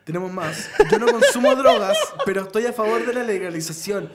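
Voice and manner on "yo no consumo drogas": much higher, louder burst; sudden shout. Pretend. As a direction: blurt it out in a yell. Reading announcer shouting.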